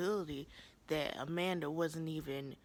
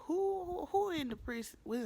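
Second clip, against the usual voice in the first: incredulous; 12 semitones higher, 3 dB louder than usual. Real, not acted. Vlogger to viewers, ranting high in disbelief. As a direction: voice jumps high and loud in disbelief. Incredulous rant about the chair.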